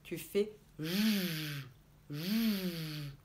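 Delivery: "J" drawn out as a long buzzing zh sound; sound demo. Shown as a demonstration. Teacher shows children the J sound, drawn out and buzzing. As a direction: hold the "J" as a slow, buzzing zh. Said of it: "Et tu fais un son".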